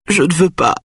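high-pitched, pleading refusal; implorant. A narrator's reading. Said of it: "implore-t-elle". (supplication aiguë et plaintive)

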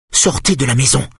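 furious. A narrator's reading. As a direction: throw him out of her house. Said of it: "dit-elle furieuse". (furieuse, ordre aigu)

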